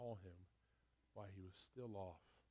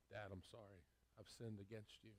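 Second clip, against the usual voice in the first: humble apology; 8 dB quieter than usual. Acted. Son finally says speech, quiet.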